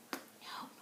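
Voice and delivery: soft breathy whisper no. Acted. Teacher answering her question to the kids, whispered. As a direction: drop to a hushed whisper.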